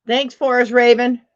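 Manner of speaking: normal talking voice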